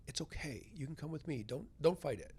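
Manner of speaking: soft, hushed, gently inviting; a dead father coaxing his son softly; soothing whisper. Retold or imitated. Hushed, gentle reassurance from a dead father.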